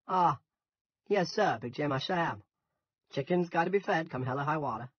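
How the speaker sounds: thick Yankee farmer being respectful; suddenly respectful. A narrator's reading. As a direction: turn obliging to the boss. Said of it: "from irritation to respect".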